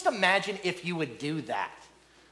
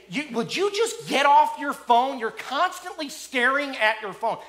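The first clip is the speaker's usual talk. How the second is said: louder and higher, slow, accusing jab; mock heated nagging; 6 semitones higher, 5 dB louder, slower than usual. Spouse snapping at partner mid-fight, mock heated nagging. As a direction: nag like it's mid-argument. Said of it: "when you're in the middle of a fight".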